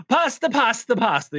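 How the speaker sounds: high-pitched mock Italian accent. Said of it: "going into an Italian accent".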